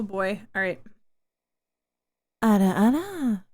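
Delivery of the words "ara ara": smooth, louder anime big-sister ara ara; sultry ara ara. Pretend. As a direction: purr ara ara like a teasing anime onee-san. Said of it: "There we go. That was better".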